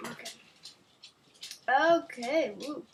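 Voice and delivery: plain reading voice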